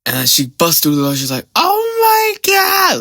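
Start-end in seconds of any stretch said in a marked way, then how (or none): 1.6-3.0 squealing, very high, slow "Oh my God"; auntie's shriek. Retold or imitated. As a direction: imitate the loud auntie shrieking. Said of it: "this is how she sound like".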